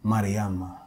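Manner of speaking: slow, emphatic spoken-word delivery with long pauses; poetry performance. Acted. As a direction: declaim it loudly, drawing out each image, then let the closing name settle. Performs a poem between talk.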